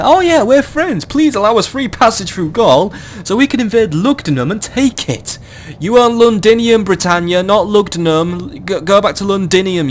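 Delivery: high, mocking voice of the Britannians; mocking impression. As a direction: sneer their words back in a high mock voice. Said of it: "you go".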